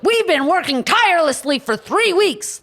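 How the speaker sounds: high, slow mocking voice of the guild leaders; mock self-important official. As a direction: sneer it out in a pompous singsong. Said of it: "They're like".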